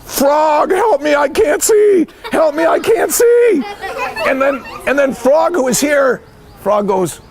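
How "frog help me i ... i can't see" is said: high, hammed-up cry for help; high, pleading storybook distress; calling for help. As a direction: wail for your friend in mock panic. Toad blinded, calling for help.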